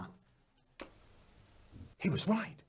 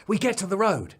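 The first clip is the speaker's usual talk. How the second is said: ordinary delivery, nothing marked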